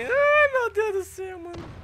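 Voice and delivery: very high, strained cry of alarm; high panicked ai meu deus do céu; panicked squeal. Real, not acted. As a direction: shriek it in sudden panic. Three enemies aiming at him.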